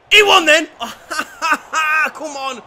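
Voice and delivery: high, loud demanding yell; exasperated fan yell; urging shout. Real, not acted. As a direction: bellow at him to shoot. Fan begging player to shoot.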